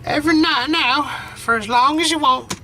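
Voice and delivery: put-on a frail old grandmother, tender and teary; grandma voice. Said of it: "only one I can go for ... old elderly lady".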